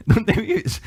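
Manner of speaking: chuckling as you repeat the question, pitch lifting at the end; amused, laughing. Retold or imitated. Preacher amused by the apostles' naive question, laughing.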